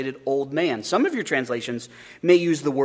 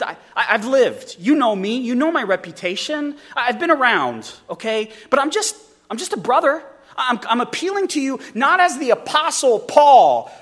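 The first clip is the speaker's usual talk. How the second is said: Paul pleading earnestly; 9 semitones higher, 5 dB louder than usual. Acted. Preacher voices Paul's appeal.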